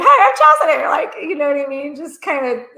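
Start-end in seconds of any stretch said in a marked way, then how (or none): 0.0-0.9 high, loud chirpy self-introduction; bright, much higher and louder imitation of a friend's cheerful hello; bubbly greeting. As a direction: greet like an eager friend meeting a stranger. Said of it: "be like".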